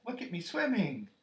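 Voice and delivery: high, loud little squeal; excited small child's cry; proud bubbling Roo. A narrator's reading. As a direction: show off proudly, piping. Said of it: "still bubbling proudly".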